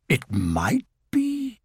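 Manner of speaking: half-dare to believe it, drawn out; growing hope. Acted.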